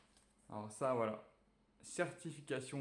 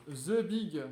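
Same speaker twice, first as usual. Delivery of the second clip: louder, drawn-out "The Big" with a falling finish; proud reveal; 3 semitones higher, 6 dB louder than usual. Acted. Youtuber unveiling the big charger with a proud, louder reveal.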